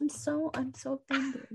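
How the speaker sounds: high, soft, fake hurt tone; mock offended. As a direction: pretend to be wounded, teasingly. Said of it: "Just kidding".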